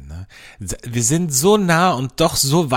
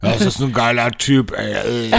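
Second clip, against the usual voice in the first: imitating blunt Cologne woman; 2 semitones lower than usual. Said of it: "und die Keller einfach".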